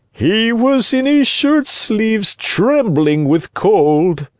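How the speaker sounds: very high, drawn-out fox voice; high, slow storybook fox; sly fox. A narrator's reading. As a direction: stretch the words out high and slyly. Fox's voice in the story.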